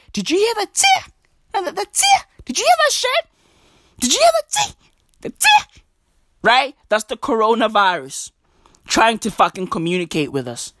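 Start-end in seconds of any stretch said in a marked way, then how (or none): 0.1-5.8 very high, squeaky panicked questioning; hammed-up high-pitched freak-out; mock alarm. Acting alarm at the sneeze.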